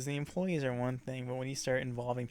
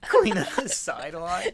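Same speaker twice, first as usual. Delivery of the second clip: loud, slow laughing echo of the phrase; laughing disbelief; 4 semitones higher, 8 dB louder than usual. Real, not acted. Friend hearing the story, laughing in disbelief. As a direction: repeat it while cracking up.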